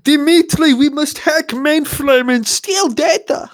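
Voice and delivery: hammed-up Russian accent, high, loud and slow; mock Russian scientist. Mad Russian scientist ordering hacker, hammed-up Russian accent, high and loud. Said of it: "crazy Russian Ukrainian scientist".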